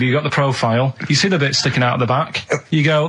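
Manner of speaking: ordinary talk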